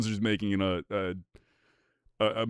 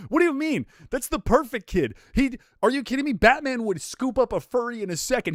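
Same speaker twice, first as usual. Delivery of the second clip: higher and louder mock outrage; excited outrage; 11 semitones higher, 5 dB louder than usual. Real, not acted. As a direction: protest loudly, high and fast.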